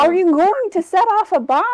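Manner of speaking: loud, high question in American English; American accent. Acted. Game master repeating the bomb question for clarity, American accent. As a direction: ask it plainly in an American accent. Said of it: "say it in an American accent".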